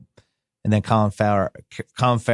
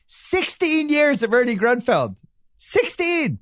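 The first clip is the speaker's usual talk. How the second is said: "Sixteen" drawn out slowly and much higher; emphatic disbelief; 14 semitones higher, slower than usual. Real, not acted. Host ribbing guest about the GM's sixteen years, emphatic. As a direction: stretch "Sixteen" high and slow in mock amazement.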